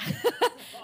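delighted laugh. Real, not acted. Laughing at his own joke.

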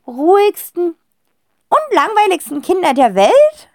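high, loud, slow appalled listing; appalled aunt. A narrator's reading. Aunt horrified by well-behaved children, appalled. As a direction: drag each word out in horror. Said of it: "ergänzt Tante Rotzinder entsetzt".

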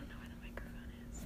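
soft, fast ASMR whisper; breathy whisper into the mic. Shown as a demonstration. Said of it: "this next segment's gonna be A S M R".

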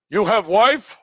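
high, loud imitation foreign accent; foreign speaker's broken English. Imagined Greek asking a man about marriage, mock foreign accent. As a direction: put on a foreign accent, rising as a question. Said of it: "but they might say".

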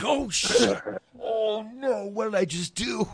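high, loud panicked voice; hammed-up alarm at a mistake; mock panic. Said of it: "And I'm like".

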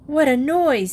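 lightly voiced indignant child; annoyed young girl. A narrator's reading. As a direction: complain crossly about the dog. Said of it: "glaring balefully at poor Old Boy".

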